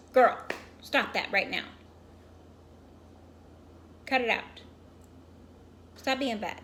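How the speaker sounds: loud, firm telling-off; real scolding. Real, not acted. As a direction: order a naughty pet to quit, loudly. Scolding her pet mid-story.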